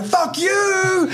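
high retold yell of defiance; defiant shout. Boy escaping, yelling at his stepdad, high shout. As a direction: yell it back from outside the window. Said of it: "I'm like".

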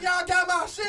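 high loud mock demand; mimicking drunk Kenny. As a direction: shout it like a drunk looking for his phone. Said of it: "He like".

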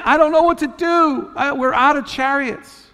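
high-pitched, helpless complaint; desperate king. Acted. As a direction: plead your case as a king at a loss. Desperate king voiced pleading for help.

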